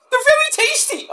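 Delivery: very high squeaky pitchman; Mick. Acted. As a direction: push the snack in a piercing squeak. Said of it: "Mick, go away".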